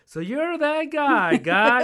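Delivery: southern sergeant. Retold or imitated. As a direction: stretch it out like a Southern sergeant having fun. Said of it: "southern draw".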